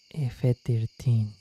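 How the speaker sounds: normal talking voice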